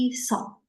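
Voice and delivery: "Iso" with a drawn-out first vowel; pronunciation demo. Shown as a demonstration. Teacher showing students how it reads, demonstrating "Iso" slowly. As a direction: say "Iso" slowly, stretching the I into a hissed s. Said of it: "Bacanya jadi seperti ini".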